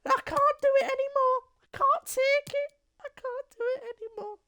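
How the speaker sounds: very high, cracking mock despair; mock Neil Warnock wail. Warnock quitting over Bannon's price, very high mock wail. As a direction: squeal it like a beaten old man giving up. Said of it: "He's just said".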